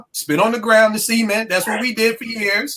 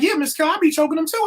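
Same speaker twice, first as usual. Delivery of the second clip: imitating teen boy; 8 semitones higher, much faster than usual. Boy quoted at table.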